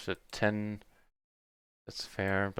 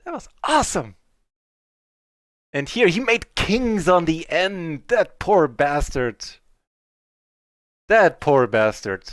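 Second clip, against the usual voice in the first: real gleeful roar at the win; excited shout; 6 semitones higher, 10 dB louder than usual. Real, not acted. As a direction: yell it out in triumph. Celebrating a big pot.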